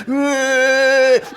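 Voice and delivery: imitated squinting, effortful grunt; high, drawn-out strain noise. Dad imitating son aiming the Nerf gun, straining grunt. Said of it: "he's, like, squinting his eyes".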